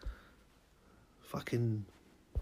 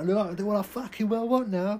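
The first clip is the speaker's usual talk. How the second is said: defiant outburst; 8 semitones higher, 7 dB louder than usual. Acted. Jester defying friend.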